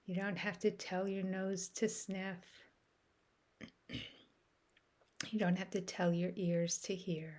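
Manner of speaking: ordinary delivery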